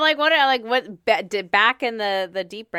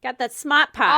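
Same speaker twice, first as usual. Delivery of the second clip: put-on loud, playful Boston accent; mock Bostonian with dropped R's; 5 semitones higher, 7 dB louder than usual. Co-host teasing Bostonians, in a loud Boston accent. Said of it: "the Bostonians".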